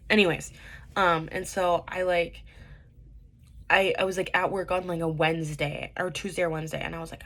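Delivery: ordinary delivery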